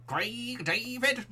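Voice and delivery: high, slow parody cry. Acted. Streamer toying with a voice for [name], high drawn-out impression. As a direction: pitch it way up and stretch both words. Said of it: "shall I do [name]".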